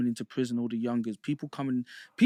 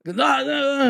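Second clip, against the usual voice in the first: dismissive inmate; 11 semitones higher, 8 dB louder, slower than usual. Retold or imitated. Inmate brushing him off.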